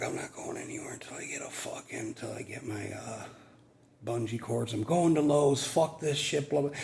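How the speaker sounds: hushed, fed-up whisper to himself; whispered frustration. Retold or imitated. Hot worker whispering his gripes to himself in frustration. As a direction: breathe the griping out under the breath. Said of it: "And I'm like".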